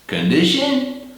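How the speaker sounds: "Condition" slow and pitched high; high rising. Shown as a demonstration. Teacher marking the first part for learners. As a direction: lift the word way up slowly. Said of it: "the condition".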